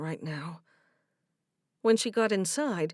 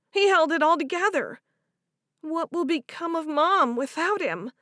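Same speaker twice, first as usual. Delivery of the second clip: put-on grieving young woman voice; 9 semitones higher, 6 dB louder than usual. Favor voiced in story.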